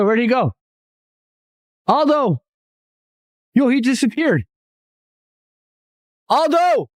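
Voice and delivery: calling out. Real, not acted. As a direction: shout his name into the woods. Friend suddenly gone, calling him.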